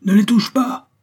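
high, loud yell; howled protest. A narrator's reading. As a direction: scream at them to stop. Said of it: "hurla quelqu'un".

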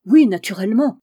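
loud, eager exclamation; sick banker crying out his agreement, loud; exclaiming. A narrator's reading. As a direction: burst out with a loud yes. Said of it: "s'écria monsieur Philippe".

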